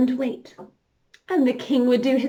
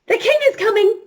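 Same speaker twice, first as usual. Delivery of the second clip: high, loud alarm call; urgent warning; 9 semitones higher, 4 dB louder, faster than usual. Pretend. Teacher alerts the class the king is coming, urgent call. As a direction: cry out that the king arrives. Game signal to children.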